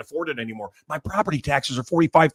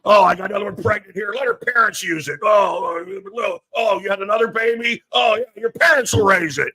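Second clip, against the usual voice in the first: mock shout; 5 semitones higher than usual. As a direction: sneer it out as a proud deadbeat. Mocking careless parents, shouted.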